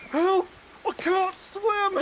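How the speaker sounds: high mock cry for help; drowning cry. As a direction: squeal it like a panicking big guy. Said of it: "He's like".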